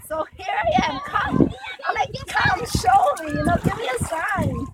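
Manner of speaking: high, insistent appeal building on "Come"; urgent plea. Retold or imitated. Praying to the Lord for a sign, urgent high plea. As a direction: call out upward, begging for a response.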